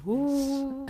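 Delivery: high "woo" like a gust; howling wind. Acted. Grandmother-style storyteller imitating the winds, howling. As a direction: imitate a rising wind howl.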